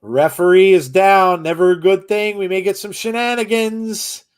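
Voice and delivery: rising excited ring call; wrestling commentator. Acted. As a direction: build up calling the action. Live play-by-play of the match.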